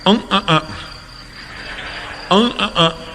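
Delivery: high, nasal grunts; nasal mumbling grunts. Retold or imitated. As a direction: hum like a man who can't say words. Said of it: "ગૂંગણો". (નાકમાંથી ઊંચા અવાજે અં અં કરતો ગૂંગણો માણસ)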